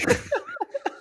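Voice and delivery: real high-pitched cackle; helpless high giggle; high laugh burst. Real, not acted. Friends cracking up mid-podcast.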